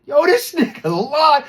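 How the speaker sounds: high, loud, slow disbelief; outraged. Retold or imitated. Telling friends he was lied to, outraged. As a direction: call out the lie in outrage. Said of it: "I'm like".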